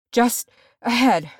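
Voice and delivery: high, loud, broken gasp; effortful words on the run; barely managed. A narrator's reading. Dwarf runner guiding his leader, winded. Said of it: "Nori managed".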